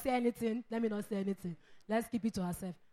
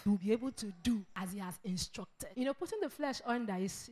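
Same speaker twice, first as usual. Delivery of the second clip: ordinary talk, nothing marked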